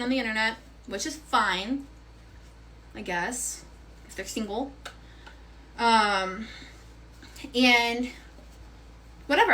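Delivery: ordinary talk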